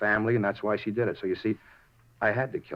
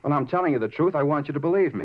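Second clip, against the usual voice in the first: higher and louder, begging; desperate plea; 6 semitones higher, 4 dB louder than usual. Acted. Suspect begging the detective to believe him, higher pleading voice. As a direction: plead with them, voice pitched up.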